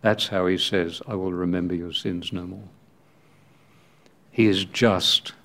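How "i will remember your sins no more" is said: softer and quick, almost under the breath; hushed promise. Shown as a demonstration. Preacher repeating God's promise in a hushed voice. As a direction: let it fall away to a hush.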